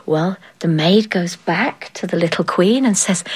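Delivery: ordinary delivery, nothing marked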